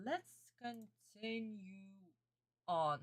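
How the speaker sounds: slow, stretched "let's continue"; drawn-out prompt. Real, not acted. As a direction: coax them along slowly, stretching the words. Drawn-out prompt, then softly echoed.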